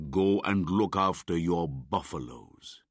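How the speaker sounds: put-on loud, gruff order to the boy; bully the herd boy off; angrily. A narrator's reading. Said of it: "he cried angrily".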